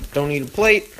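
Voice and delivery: ordinary delivery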